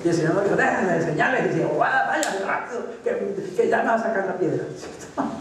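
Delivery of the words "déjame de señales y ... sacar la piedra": impatient master. Pretend. Mocking an impatient Lord.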